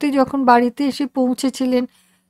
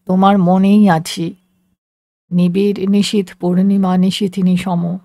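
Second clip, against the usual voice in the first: low-pitched voice of the man in her memory; low, poetic lover from the past; remembered beloved; 6 semitones lower than usual. A narrator's reading. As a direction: answer her in a much lower, lyrical voice. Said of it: "সে বলল".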